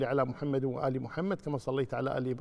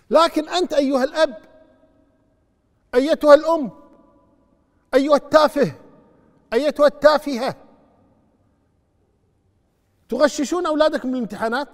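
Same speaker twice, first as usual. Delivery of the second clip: loud, high righteous rebuke; scolding sermon; 11 semitones higher, 12 dB louder than usual. Acted. Preacher scolding parents who help children cheat, furious. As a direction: berate the parents from the pulpit.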